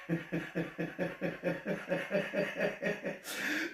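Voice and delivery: long rhythmic chuckle; laughing. Real, not acted. Host laughs at Yanks having no clue, long chuckle. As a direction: laugh on and on in even pulses.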